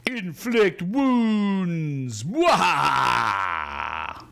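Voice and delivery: slow, loud, drawn-out evil cackle; evil villain cackle. Acted. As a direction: gloat like a cartoon villain. Villain casts spell, evil laugh.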